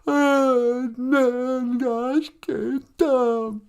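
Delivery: very slow, high mock wail; mock sad. Teacher acts out the Ojibwe word for sad, mock wailing. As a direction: draw it out in hammed-up sorrow. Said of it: "are you sad?".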